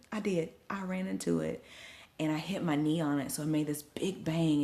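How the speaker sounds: ordinary delivery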